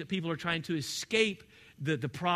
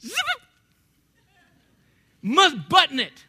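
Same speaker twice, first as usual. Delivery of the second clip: mock stern order; 8 semitones higher, 8 dB louder than usual.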